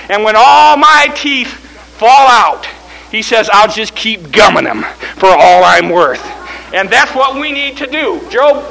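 rousing, punched-out sermon; full-force preaching. Acted. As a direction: drive each phrase home like a revival preacher.

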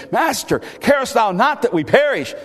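high, loud plea to wake him; urgent cry. A narrator's reading. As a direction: cry out to the master in alarm. Reading disciples' cry to sleeping Jesus.

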